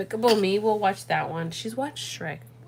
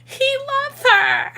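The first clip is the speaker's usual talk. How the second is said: high, slow fake sob; mock crying; 6 dB louder, much slower than usual. Mom hamming up her tears over the Elemental ending. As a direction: wail it like a hammed-up weepy viewer. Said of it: "'cause I'm like".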